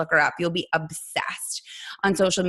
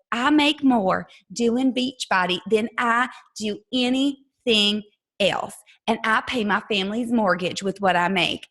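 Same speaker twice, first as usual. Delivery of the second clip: put-on East Texas accent. Coach tells a recruit about her earnings, East Texas accent. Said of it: "I'm gonna do an accent for y'all".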